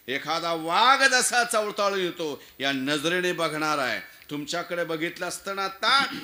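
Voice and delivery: forceful testimony. Acted. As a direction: insist that you know the man by sight. Khan's son insists he knows Shivaji.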